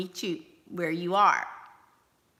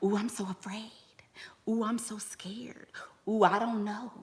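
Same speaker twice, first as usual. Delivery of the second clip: breathy mock-frightened whisper; mock timid whisper; 6 dB quieter than usual. Mocking timid women, hammed-up frightened whisper. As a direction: whisper it like a scared little mouse. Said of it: "Being timid means that you're nice".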